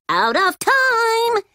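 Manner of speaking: high, slow storybook clock voice; talking clock. A narrator's reading. Clock announces time is up to the friends, high sing-out. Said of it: "said the clock".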